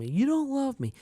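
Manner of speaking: put-on high, childish wail; son's accusation. Little son accusing his dad, in a high childish voice. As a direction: voice the upset little boy.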